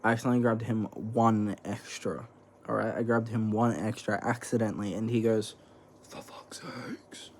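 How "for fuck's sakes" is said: hissed, slow whispered curse; imitated angry whisper. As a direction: breathe the curse out under your breath, furious. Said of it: "In that exact thing, like, Superman".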